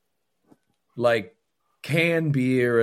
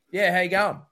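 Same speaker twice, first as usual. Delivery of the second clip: a higher, mock broad Australian hello; broad Australian greeting; 7 semitones higher, faster than usual. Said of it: "that was just how I".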